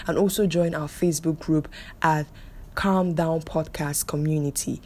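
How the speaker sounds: normal talking voice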